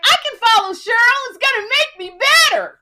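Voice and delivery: high, loud mock-cocky inner voice; mock naive confidence. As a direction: play your younger self brimming with bravado. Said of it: "I was like".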